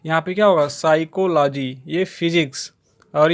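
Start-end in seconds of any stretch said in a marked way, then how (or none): 0.7-1.8 साइकोलॉजी spelled out slowly, sigh-ko-lo-jee; careful pronunciation demo. Shown as a demonstration. Teacher showing students how to say it, slow and careful. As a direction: say साइकोलॉजी slowly and clearly, syllable by syllable. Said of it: "मैं इसका pronunciation करके आपको दिखा देता हूँ".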